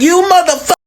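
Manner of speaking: high, fierce grandma command; grandma yelling. Retold or imitated. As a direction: bark it like a bossy grandmother. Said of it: "And she like".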